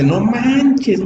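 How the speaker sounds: muy agudo, exclamando asombrado con la boca abierta; boca bien abierta. Shown as a demonstration. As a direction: suelta el "No manches" agudo, abriendo bien la boca. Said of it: "abre más y dice". (exclamación asombrada y aguda, "No manches" con la boca muy abierta)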